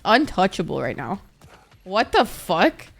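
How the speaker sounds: normal talking voice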